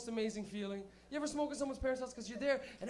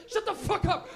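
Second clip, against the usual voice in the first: higher, louder, laughing snap; rough retort; 9 semitones higher, 11 dB louder, slower than usual. Retold or imitated. Friends snapping at the weak kid, loud and mocking. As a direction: shut him down hard.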